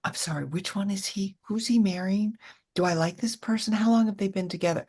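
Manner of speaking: a lightly voiced lost actor fumbling; mild sheepish confusion; embarrassing actor. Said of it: "that embarrassing actor going".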